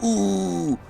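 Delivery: high, drawn-out "ooh"; crowd's "ooh". Retold or imitated. Classmates reacting to the thrown phone, imitated high ooh. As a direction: imitate a roomful of onlookers going ooh.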